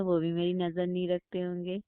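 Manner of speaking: normal talking voice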